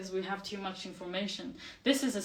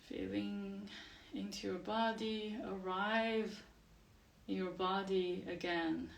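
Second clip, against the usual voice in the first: guided meditation; 8 dB quieter than usual. Acted. Teacher settles class with eyes closed, guided meditation. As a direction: gently guide them into the body, softly and unhurried.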